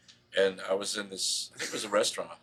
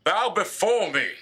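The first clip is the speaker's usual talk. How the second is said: booming, higher and louder, slow command; dark lord; 10 semitones higher, 6 dB louder, slower than usual. Pretend. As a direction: proclaim it like a grand ruler, laughing. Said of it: "I was like".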